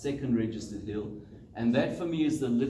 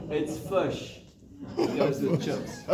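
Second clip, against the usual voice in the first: "fish" said as a KZN "fush", pitched up; KZN accent; 3 semitones higher, slower than usual. Shown as a demonstration. Coach demos the local vowel, amused. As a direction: do the Durban "fush" with a laugh. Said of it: "get the accent right".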